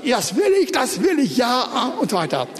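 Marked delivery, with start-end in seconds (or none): 0.0-1.4 high, ringing "das will ich" said twice; eager declaration. Shown as a demonstration. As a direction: call out the promise with zeal. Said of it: "Wirklich auf, sagt".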